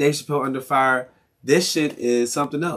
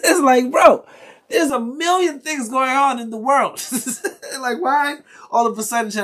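exasperated outburst; 10 semitones higher than usual. Real, not acted. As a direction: throw up your hands, voice jumping high. Exasperated rant, laughing.

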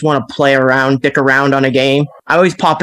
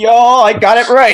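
pleased-with-himself voice, pitched up; higher, self-satisfied put-on voice; mock proud; 6 semitones higher, 3 dB louder than usual. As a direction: imitate someone chuffed at getting it right. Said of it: "like, proud of himself".

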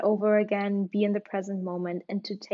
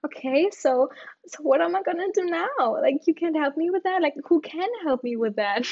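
exasperated; 7 semitones higher, 3 dB louder than usual. Retold or imitated. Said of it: "I was just saying".

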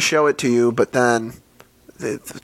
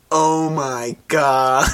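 mock awe; much slower than usual. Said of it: "you're like this".

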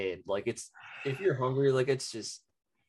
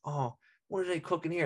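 a hint of a higher, breezy American cooking-video host; 5 semitones higher than usual. Imitating Italian American TikTok cooks in their American voice. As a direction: lightly imitate a TikTok cook narrating. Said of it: "their American voice".

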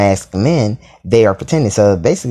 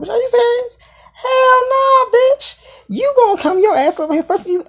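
high-pitched, scandalised sass; shrieking high in outrage; indignant tirade. Retold or imitated. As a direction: scold him, sky-high and scandalised.

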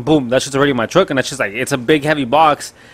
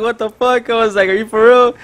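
high-pitched incredulous reaction; stunned, high-voiced questioning; retold disbelief; 9 semitones higher, 4 dB louder than usual. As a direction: replay your disbelief, pitched way up. Retelling his stunned reply to driver.

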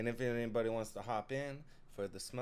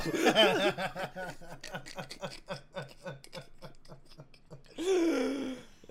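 long high wheezing laugh near tears; helpless laughing fit; 8 semitones higher, 6 dB louder than usual. Real, not acted. Cohost's joke hits, laughing fit. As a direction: laugh till it almost turns to crying.

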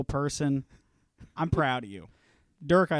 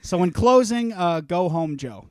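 raised voice, pretend annoyed; loud mock dismissal; 4 semitones higher, 9 dB louder than usual. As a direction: tell him off loudly, half joking. Mock angry sign-off.

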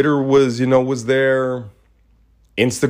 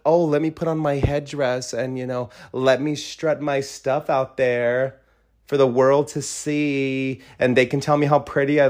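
mocking imitation; 2 semitones higher, 4 dB quieter than usual. Mocking the woman who wore the headdress, sneering mimicry.

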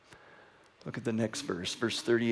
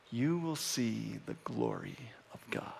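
hushed promise; 2 semitones lower, 11 dB quieter than usual. Retold or imitated. Jesus promising Martha God's glory, hushed and reverent. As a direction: let it sink almost to a whisper.

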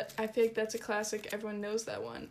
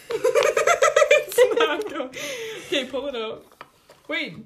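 laughing through words; high, loud laughing; 8 semitones higher, 13 dB louder, slower than usual. Real, not acted. Nail dip going wrong.

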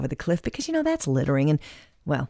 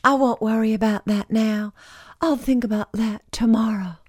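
Scarlett O'Hara quote; 6 semitones higher than usual. Retold or imitated. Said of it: "as she said time and time again".

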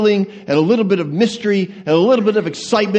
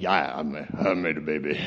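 much lower, softer proud dad; low, hushed proud new dad; proud new father; 9 dB quieter than usual. Retold or imitated. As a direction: boast like a bashful new father. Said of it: "It's like".

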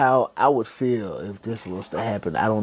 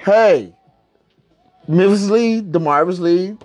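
calling out; 7 semitones higher, 5 dB louder than usual. Real, not acted. Shout-out to the co-host.

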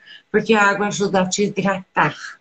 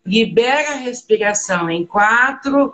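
guided meditation; 2 semitones higher than usual. Acted. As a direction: lead them gently into the breath. Leads a breathing meditation.

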